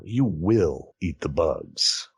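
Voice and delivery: ordinary talk, nothing marked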